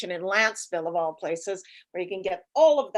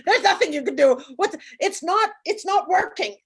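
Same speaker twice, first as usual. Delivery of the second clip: panicked husband; 8 semitones higher, 5 dB louder than usual. Retold or imitated. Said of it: "He goes".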